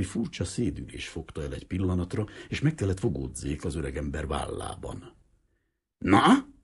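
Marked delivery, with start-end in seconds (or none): 6.0-6.5 high, loud, curt snort of a word; loud gruff old man's prod; snorted challenge. Acted. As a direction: snort it at him impatiently. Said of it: "horkant rá az öreg".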